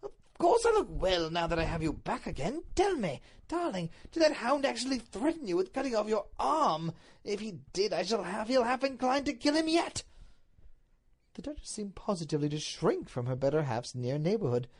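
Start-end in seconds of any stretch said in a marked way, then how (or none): 0.0-10.0 higher, lively husband's voice; worked-up Duke in an audiobook; agitated doting Duke. Acted. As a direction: fuss over your wife with rising indignation. Duke voiced, fussing over wife.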